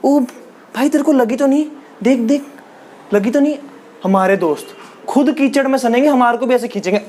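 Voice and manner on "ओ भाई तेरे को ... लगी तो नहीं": lightly higher, fussing over a friend who fell; a hint of a worried pal; overly caring friend. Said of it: "अगर ऐसे simple भी गिर जाए ना". (गिरे दोस्त की हल्की नकली फ़िक्र)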